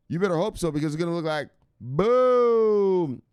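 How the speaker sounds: hype-man payoff; hyped boom. Real, not acted. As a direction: stretch it out and land the boom. Hyping portfolio gains.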